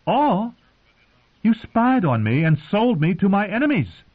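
sharp, high, outraged questioning; outraged accusation. Acted. Queen confronting her knight, outraged. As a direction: hurl the betrayal back at him.

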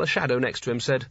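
usual speaking voice

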